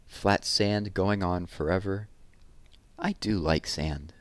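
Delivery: put-on lively, lilting read-aloud to children; poem reading. A narrator's reading. Teacher reading a summer poem to children, lively and lilting. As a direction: savour each image, bright and rhythmic.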